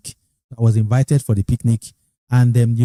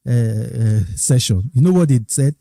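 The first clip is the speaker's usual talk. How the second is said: normal talking voice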